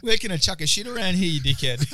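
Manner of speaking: hammed-up rough Aussie accent; Aussie translation. Shown as a demonstration. Joking Aussie version of asking for a toilet, broad Aussie. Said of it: "that would be the Aussie translation".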